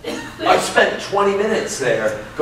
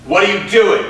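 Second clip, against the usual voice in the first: louder, pointed question; sharp demand; 5 semitones higher, 4 dB louder than usual. Shown as a demonstration. Teacher demoing an accusing take, sharp loud question. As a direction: confront them with it. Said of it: "How about this?".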